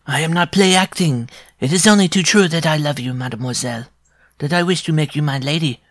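high, earnest little man's voice; insistent dwarf suitor. A narrator's reading. Dwarf insists his love is real.

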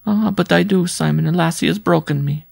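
high, woeful woman's voice; suffering woman visitor. A narrator's reading. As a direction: confess it high, as a lament.

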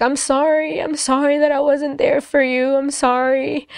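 a mom's tearful apology, understated; crying apology. Retold or imitated. Mom hugging her daughter, a touch of tearful sorry. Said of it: "she just began crying".

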